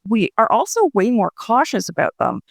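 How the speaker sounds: normal talking voice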